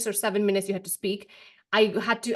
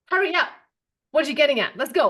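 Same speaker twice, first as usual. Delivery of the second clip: louder, higher impatient hurry-up; 5 semitones higher, 4 dB louder than usual. Pretend. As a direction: hurry them along, exasperated. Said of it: "I feel like I'd be like".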